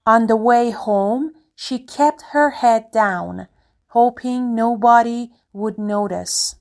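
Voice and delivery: slow, loud storybook read; story reading. Acted. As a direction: read out each phrase clearly and slowly. Reads story sentence aloud.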